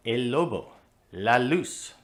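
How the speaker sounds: "lobo" and "luz" with a light, forward L; light Spanish L. Shown as a demonstration. Teacher showing correct Spanish L. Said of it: "Those L's are supposed to be light".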